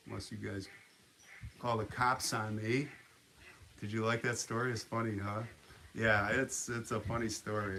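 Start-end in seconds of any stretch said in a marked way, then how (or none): none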